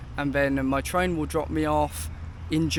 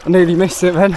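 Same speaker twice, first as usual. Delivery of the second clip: breathless loud shouting; 3 semitones higher, 11 dB louder, faster than usual. Real, not acted. Climbing out of the river.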